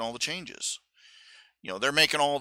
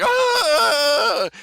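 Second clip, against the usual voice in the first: high, loud mock shriek of alarm; the guards shriek at the sight; imitated scream; 14 semitones higher, 9 dB louder than usual. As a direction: shriek in fright like startled guards. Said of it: "the guys are like".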